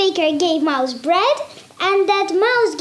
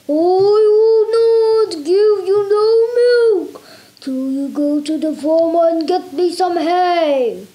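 grumpy cow; 3 dB louder than usual. Acted. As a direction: boom it out like a cross cow. Cow refuses mouse.

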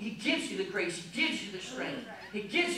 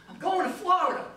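put-on excited kid; 7 semitones higher, 4 dB louder than usual. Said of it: "Eli, what's going on?".

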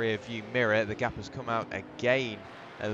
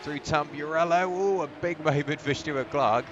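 louder and higher as the car slides; real rising alarm at a big moment; excited commentary; 4 semitones higher, 5 dB louder than usual. Real, not acted. Car twitches sideways mid-battle.

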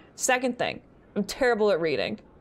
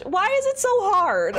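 mock despair; 8 semitones higher, 3 dB louder than usual. Real, not acted. Mock despair at failing English.

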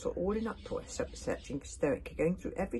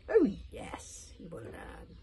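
menacing witch; 3 semitones higher, much slower than usual. Acted. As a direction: let the threat drop to a hush. Witch's threat aimed at Hiccup.

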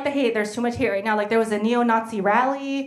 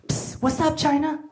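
a hint of a sleazy club guy, higher; 8 semitones higher than usual. Nightclub guy calling to her, lightly voiced. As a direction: hiss it like a pickup line.

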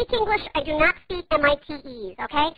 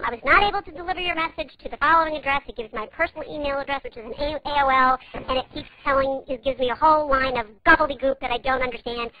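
usual speaking voice

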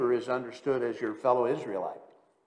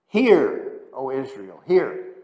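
proclaimed call; 7 dB louder, slower than usual. Acted. Quoting the Shema aloud.

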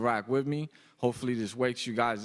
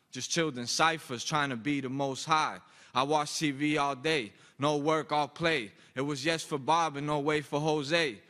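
rhythmic, rhyming verse with falling line ends; spoken word poem; 4 semitones higher than usual. Acted. Poet performing first piece for an audience, spoken word. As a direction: recite it as measured slam poetry.

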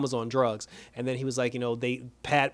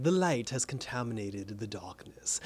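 slow, lower, softer doom voice; the Shade grave; 4 semitones lower, 6 dB quieter than usual. Retold or imitated. The Shade explaining why the door is shut, slow and low. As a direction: intone it slowly and darkly. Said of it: "he's like".

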